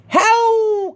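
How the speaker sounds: high howling call; mama werewolf howl. A narrator's reading. Mother werewolf calling her pups, a high howl. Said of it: "called the ma".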